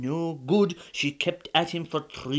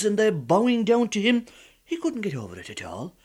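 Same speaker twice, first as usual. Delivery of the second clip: ordinary delivery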